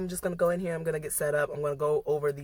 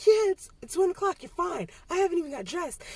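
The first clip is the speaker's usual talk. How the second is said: higher, breezy friend voice; imitating a friend; 14 semitones higher than usual. Study group lady reassuring her, imitated higher and breezy. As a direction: play the unbothered friend, pitched up. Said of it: "she was like".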